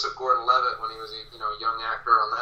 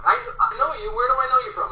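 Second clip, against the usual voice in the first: high, insistent voice of a stranger; eager fan; 11 semitones higher, 3 dB louder, faster than usual. Retold or imitated. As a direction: lightly mimic a fan who won't let it go. Same fan dialogue repeated.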